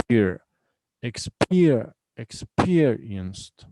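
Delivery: "experienced" with a strong, drawn-out PEER; stress on second syllable. Shown as a demonstration. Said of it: "stress is on the second syllable".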